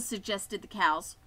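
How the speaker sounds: usual speaking voice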